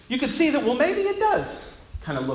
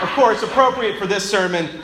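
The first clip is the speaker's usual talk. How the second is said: ordinary talk, nothing marked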